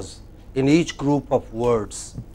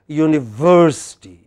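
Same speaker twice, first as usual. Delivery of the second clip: "university" with "ver" strongly punched; stress on VER. Shown as a demonstration. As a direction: lean hard on the "ver" in "university". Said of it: "And the where is pro".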